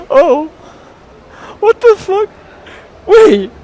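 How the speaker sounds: high, loud, incredulous squeal; shocked disbelief. Real, not acted. Streamer reacting to his first time, high shocked disbelief. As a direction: exclaim it high and loud, stunned. Still shocked about open bag.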